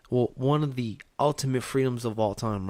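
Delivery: usual speaking voice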